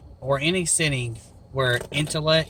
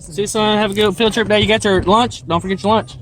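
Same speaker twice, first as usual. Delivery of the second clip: raised voice across the drive; calling out; 6 semitones higher, 7 dB louder than usual. Real, not acted. Parent calling goodbyes and reminders to son, loud. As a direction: call out loud and high to the son heading off.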